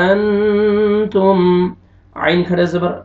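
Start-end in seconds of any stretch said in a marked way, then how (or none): 0.0-1.4 अन तुम with the न hidden and hummed through the nose, very slow; अन तुम recited very slowly with a nasal ikhfa hum; nasalized ikhfa. Shown as a demonstration. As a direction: draw out अन तुम, sending the न into the nose. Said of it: "इख्फा करेंगे".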